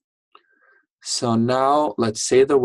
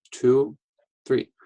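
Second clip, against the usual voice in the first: normal talking voice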